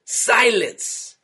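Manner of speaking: high sharp shout of command. A narrator's reading. King silences all the snakes, high shout. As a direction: silence the whole cavern. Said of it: "Viper the Vicious then shouted".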